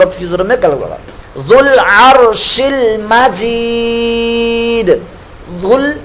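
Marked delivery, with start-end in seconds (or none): 1.5-6.0 very slow melodic tajweed chant; slow Quran chant; Quran recitation. Shown as a demonstration. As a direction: draw out ذو العرش المجید. Teacher recites the verse.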